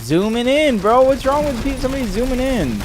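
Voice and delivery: high, loud defensive outburst; indignant protest. Real, not acted. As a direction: protest it, half laughing. Defending his phrase, indignant.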